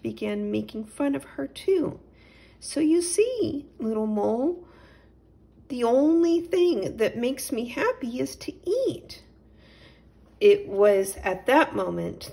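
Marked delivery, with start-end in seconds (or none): 2.6-9.2 higher, plaintive confiding cat voice; cat telling the little mole why she eats; sad cat explaining. Acted.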